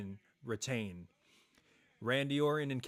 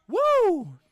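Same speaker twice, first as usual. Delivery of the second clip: high piercing cheer woo; loud woo; 14 dB louder than usual. Real, not acted. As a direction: let out a huge woo. Cheering the action.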